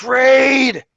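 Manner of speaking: loud, high shout of alarm; yelled alarm. Acted. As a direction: yell it out as a warning. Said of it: "and he yells".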